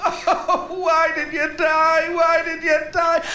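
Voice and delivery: slow, high hammed-up wail of grief; mock sobbing. Mourner wailing over a grave, hammed-up slow sobbing. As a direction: overdo a graveside lament, slowly. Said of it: "sobbing his heart out".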